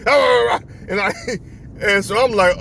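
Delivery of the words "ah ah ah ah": loud, higher-pitched mock barking; imitated dog barking. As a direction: bark loudly like an angry dog. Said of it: "he went back to barking".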